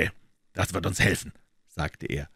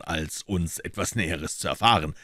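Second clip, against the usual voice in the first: plain reading voice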